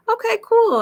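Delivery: light up at the good news; excited reaction. Real, not acted. Host reading a newcomer's chat answer, high and delighted.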